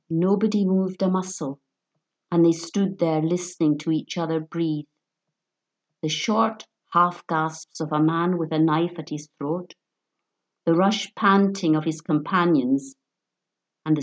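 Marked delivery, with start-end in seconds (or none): none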